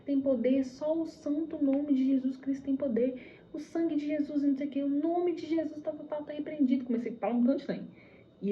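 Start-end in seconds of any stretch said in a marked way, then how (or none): 0.0-6.8 mildly raised, higher-pitched invocation; retold crying out. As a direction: re-enact calling on Jesus for power. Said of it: "eu comecei a gritar e clamar".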